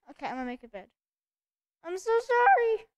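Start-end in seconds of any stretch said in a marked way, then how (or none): 1.8-2.9 apology that cracks up high. Real, not acted. Player apologising to the sheep, voice cracking. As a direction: let the voice break on it. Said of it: "That was a very big voice crack".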